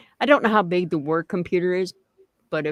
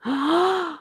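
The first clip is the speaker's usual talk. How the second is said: excited gasp; 10 semitones higher than usual. Real, not acted. Excited reaction to pudding.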